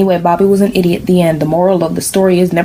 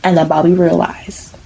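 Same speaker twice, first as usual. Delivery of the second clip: ordinary delivery, nothing marked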